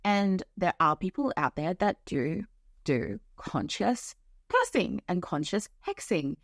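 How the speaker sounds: ordinary talk, nothing marked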